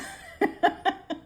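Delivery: high burst of laughter; laughing. Real, not acted. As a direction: break up laughing at your own remark. Amused by own remark.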